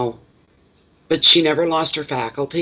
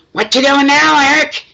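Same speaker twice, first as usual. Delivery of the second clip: high, loud bossy holler; loud shout; 14 semitones higher, 8 dB louder than usual. Retold or imitated. Old neighbour catching Eric by the ear, shouting high and loud. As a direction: bark it out like a tiny old lady grabbing an ear. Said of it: "That's how she talked".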